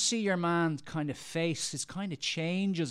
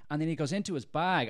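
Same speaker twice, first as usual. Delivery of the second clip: ordinary delivery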